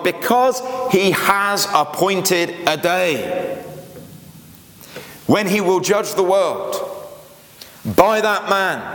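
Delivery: slow, measured reading of the verse; preacher reads the verse slowly to the congregation; solemn scripture reading. Acted. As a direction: read it out like Scripture from the pulpit.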